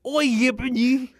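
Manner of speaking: whisper-shout it with an English accent. Shown as a demonstration. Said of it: "in an English accent".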